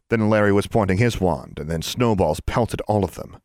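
ordinary delivery

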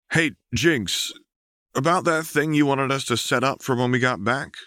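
light friendly guy voice; lightly voiced Chuck. A narrator's reading. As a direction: pilot calling back from the controls.

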